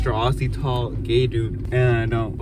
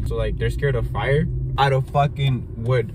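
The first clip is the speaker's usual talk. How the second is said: usual speaking voice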